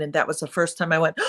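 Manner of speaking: ordinary delivery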